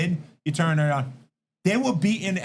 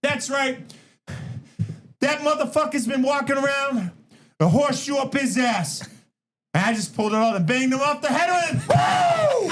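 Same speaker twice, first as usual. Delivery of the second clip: Brock promo; 8 semitones higher than usual. Retold or imitated. Brock hyping the crowd after the win, high and shouted. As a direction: rave like a hyped-up champ. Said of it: "Brock grabs the mic and goes".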